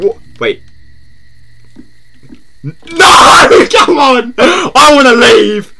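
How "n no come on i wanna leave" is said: high, screamed plea, last words drawn out; real panic. Real, not acted. Scared gamer begging to leave, screaming panic. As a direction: scream and beg to get out. Terrified, wants to leave game.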